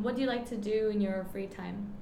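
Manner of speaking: ordinary talk, nothing marked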